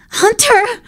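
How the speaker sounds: high, loud calling out of the name; overjoyed shout of a name; joyful cry. Acted. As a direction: call the baby's name in delight. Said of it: "Jasper cried".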